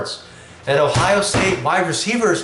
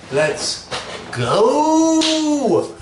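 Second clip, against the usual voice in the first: let's... GOOO!; hype shout; 9 semitones higher than usual. Real, not acted. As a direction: yell the go like a crowd chant. Big celebratory hype call.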